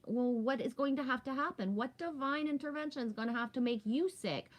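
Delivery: ordinary talk, nothing marked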